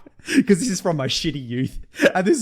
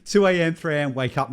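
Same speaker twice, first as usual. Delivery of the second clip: ordinary delivery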